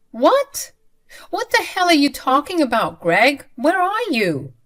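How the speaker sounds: loud, high, disbelieving outcry; shocked outburst. Retold or imitated. Wife yelling at husband over the phone, loud and shocked. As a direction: shout your disbelief down the phone.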